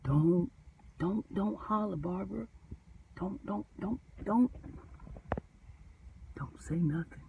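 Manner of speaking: soft, urgent hush; hushing runaway slave. Retold or imitated. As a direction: plead with her to stay quiet. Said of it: "it's like the runaway slave said".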